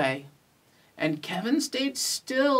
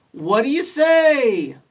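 high, loud cheering invitation; big, raised group call; crowd joining in; 5 semitones higher, 7 dB louder than usual. A narrator's reading. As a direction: call it out as a whole eager crowd. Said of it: "the crowd all joined in".

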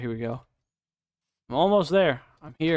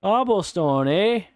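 higher, louder mock Canadian cobblestone, eh; Canadian accent; 5 semitones higher, 4 dB louder, slower than usual. Mocking the block name, hammed-up Canadian accent. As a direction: ham up a Canadian twang on cobblestone. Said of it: "Like we're fucking Canadian?".

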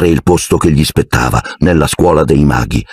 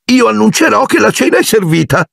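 aunt's line. A narrator's reading. Petunia rehearses her part.

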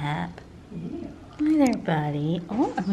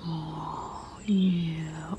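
hushed coo while scratching; soft whisper; 6 dB quieter than usual. Real, not acted. Whispering to the cat during chin scratches, hushed.

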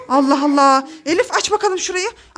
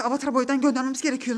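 softer, quick and serious, no laughing; serious hushed repeat; 5 semitones lower, 6 dB quieter, faster than usual. Acted. Mother redoing the line without laughing, hushed and serious. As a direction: say it again straight-faced and hushed. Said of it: "gülmeyin bir dakika".

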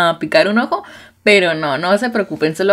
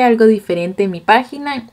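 normal talking voice

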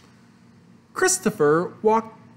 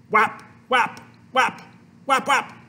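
short, high, punchy smacks; whapping noises; 5 semitones higher, 3 dB louder than usual. A narrator's reading. Reader imitates hands whapping clay, whapping noises. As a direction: pop out each whap crisply. Sound effects of shaping clay.

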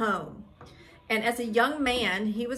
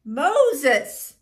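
booming second call of a name; loud calling voice; 4 semitones higher, 7 dB louder than usual. A narrator's reading. God calling Moses from the burning bush, loud voice. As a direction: call out loudly, stretching the name. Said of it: "said a loud voice".

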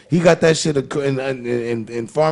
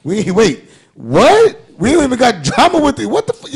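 rising, louder confused disbelief; escalating disbelief; 5 semitones higher, 4 dB louder than usual. Retold or imitated. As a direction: start calm, climb higher and louder into shock.

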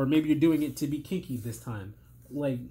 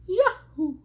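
much higher, loud cartoon plumber cry; Mario yahoo; 5 dB louder than usual. Straining voice gives one last Mario yahoo, high and loud. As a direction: leap into it with a jumping yell. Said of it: "I can't even do the Mario voice".

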